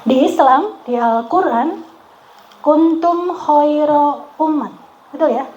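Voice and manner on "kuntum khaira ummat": slow chanted recitation of the verse; Arabic verse recited in a slow chant; Quranic recitation. Acted. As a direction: intone it like Quran reading. Quoting the Quran.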